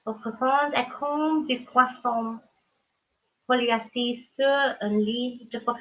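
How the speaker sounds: slow, hushed mock-poetic French recital; mock recital of a French poem. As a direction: recite the French like grand poetry, slowly. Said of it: "as the poet once said".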